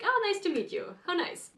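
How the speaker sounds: high, chirpy mock-delighted reply; light, sing-song imitation of a friendly welcome; pleased greeting. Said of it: "And people were like".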